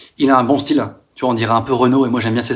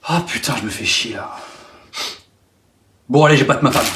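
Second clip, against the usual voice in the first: dumb brute voice; 3 semitones lower, 3 dB quieter than usual. Retold or imitated. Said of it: "des énormes cons qui se disent".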